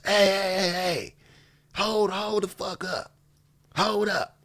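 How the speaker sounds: high mock yell of hold up; retold yell. Imitating a teammate stopping pickup with a yell. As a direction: call the game to a stop, hammed up. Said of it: "He yells".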